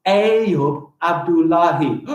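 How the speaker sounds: slow, evenly beaten "Ayub Abdullahi"; drawn-out demo of "Ayub Abdullahi"; syllable by syllable. Shown as a demonstration. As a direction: count out "Ayub Abdullahi" one syllable at a time. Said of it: "That is six syllables".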